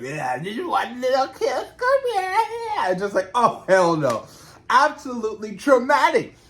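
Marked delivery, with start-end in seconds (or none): 0.0-2.4 slow coaxing creepy voice jumping high on come here; creepy body-part monster. Retold or imitated. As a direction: lure her in like a ghoulish monster. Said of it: "They're like".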